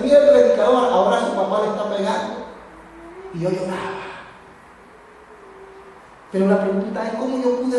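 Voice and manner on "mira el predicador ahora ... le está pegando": mocking. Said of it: "algunos se reían y decían".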